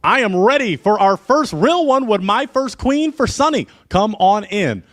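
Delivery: loud, high, stretched-out show introduction; announcer intro. Acted. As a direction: call the contestant in like a game-show host. Host announces the contestant.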